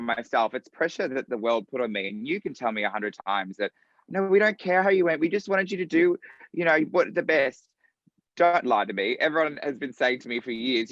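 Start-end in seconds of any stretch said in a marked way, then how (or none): 4.1-7.6 mock reassuring voice. Mocking people's reassurances.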